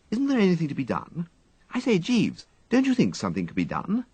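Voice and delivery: put-on a flustered young toff pleading with his valet; Wooster. Wooster appeals to Jeeves.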